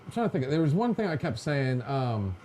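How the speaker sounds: usual speaking voice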